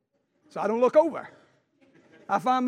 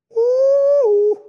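high, loud held ooh; ecstatic preacher cry; 10 dB louder than usual. Real, not acted. Preacher crying out over good news, high ooh. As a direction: let out a long joyful whoop.

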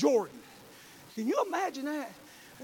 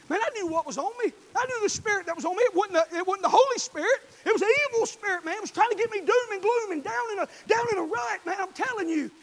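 loud, high, driving preacher's cry; preaching at full force; 8 semitones higher, 5 dB louder than usual. Acted. Pastor shouting the gospel to his church, full force. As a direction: preach it hard to the congregation. Preaching about the evil spirit.

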